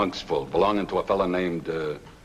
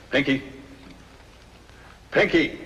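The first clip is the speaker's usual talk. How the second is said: calling out; 8 semitones higher than usual. Acted. Brother-in-law calling the driver over, shouting. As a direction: yell his name across the yard.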